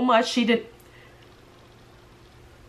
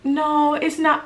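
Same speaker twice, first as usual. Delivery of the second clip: higher, prissy fake-sweet denial; mock sugarcoating; 7 semitones higher, much slower than usual. Refusing to sugarcoat, in a mock prissy higher voice. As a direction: lampoon the sugarcoated version. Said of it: "be like".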